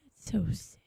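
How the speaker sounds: hushed, unhappy whisper; sad whisper. Real, not acted. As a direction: whisper it in sympathy. Mourning a game character's sacrifice.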